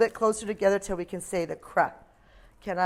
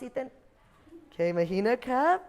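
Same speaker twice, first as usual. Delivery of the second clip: usual speaking voice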